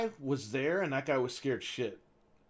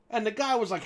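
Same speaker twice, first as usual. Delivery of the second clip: ordinary delivery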